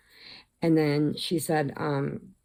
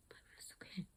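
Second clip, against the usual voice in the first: sarcastic murmur under the breath; whispered aside; 25 dB quieter, much faster than usual. Real, not acted. Teller mocking the heroine's mistake, whispered. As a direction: drop to a dry whisper.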